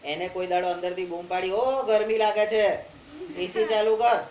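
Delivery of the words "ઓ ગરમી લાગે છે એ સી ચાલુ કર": loud playful call from inside the body; mock yell, slow; mock shout. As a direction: holler it like a complaining organ. Organ voiced calling out from inside.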